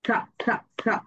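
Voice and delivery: সা repeated with crisp s; letter sound sa. Shown as a demonstration. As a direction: drill "সা" three times. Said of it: "এস sounds like সা".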